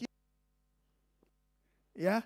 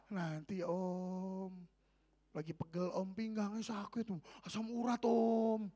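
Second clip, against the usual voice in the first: slow, soft, feeble excuse-making; whiny excuses; 2 semitones lower, 7 dB quieter, slower than usual. Retold or imitated. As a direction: drag out each excuse like a tired old man. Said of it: "Alasan terus".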